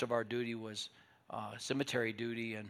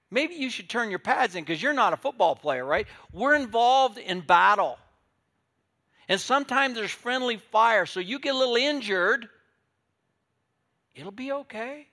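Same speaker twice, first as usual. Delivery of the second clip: loud, high preaching climax; full-force preaching; 10 semitones higher, 10 dB louder than usual. Real, not acted. Preacher loudly urging believers to toughen up. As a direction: shout it to the back pews.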